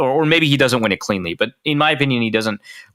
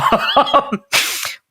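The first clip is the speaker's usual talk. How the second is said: breathless laugh; 12 semitones higher than usual. Real, not acted. Laughing at own joke.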